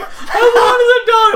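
hammed-up high wailing shout; loud, drawn-out imitated tearful tantrum; mock crying. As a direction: bawl it slowly like a heartbroken kid. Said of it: "and she starts crying".

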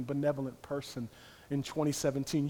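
ordinary talk

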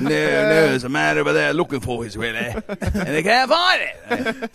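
put-on British mob accent, slowing and rising into a laugh; mock British gangster. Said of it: "That's how they talk".

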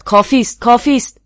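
loud, high angry cry, rising on the repeat; furious shout. A narrator's reading. An angry man in the crowd shouts the prisoner down. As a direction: yell at him to stop, enraged. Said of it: "با صدای به غیظ آمده فریاد زد".

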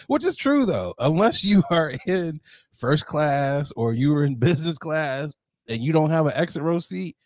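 ordinary talk, nothing marked

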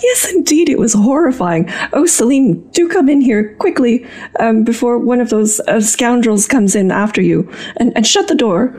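fussy Countess. Acted. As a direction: hurry him in like a fretful aristocrat. Countess urges Salim inside.

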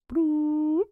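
high, loud cartoon bloop noise; silly bloop. Moving on to the next question, a high, loud goofy bloop.

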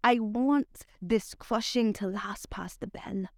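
measured spoken word delivery; poetry reading. Acted. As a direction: perform it like a slam poem, savoring lines. Spoken word poem recital.

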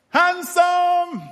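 high, loud drawn-out call, retold; calling out to someone. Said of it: "I heard this voice".

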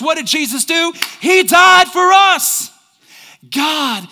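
preacher's rising crescendo; preaching, building. Real, not acted. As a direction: build line by line to a high, loud climax.

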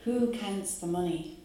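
normal talking voice

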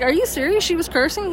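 incredulous, voice lifting into the question; retold disbelief. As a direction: replay your own astonished reaction. Retold disbelief at hearing of cursing.

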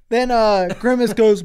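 normal talking voice

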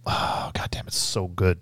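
whispered awe; hushed, reverent praise. Real, not acted. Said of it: "whispered awe".

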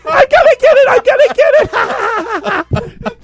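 very high, loud playful squeal; giddy kitten voice. Retold or imitated. Partners in kitty mode squeal at the loose thread.

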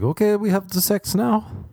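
high, brisk German woman; a hint of a German accent; mock German accent. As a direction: rattle it off like an efficient German. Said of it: "she was so German".

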